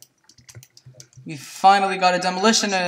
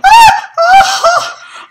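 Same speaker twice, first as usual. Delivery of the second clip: high shrieking scream; sudden piercing scream; 11 dB louder than usual. Real, not acted. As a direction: let out a real startled scream. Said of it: "That scream".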